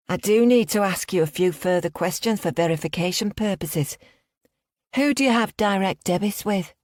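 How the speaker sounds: high, put-on Liverpudlian accent. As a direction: fake a Liverpool bank caller. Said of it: "I put on a strong Liverpudlian accent".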